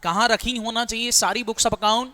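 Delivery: normal talking voice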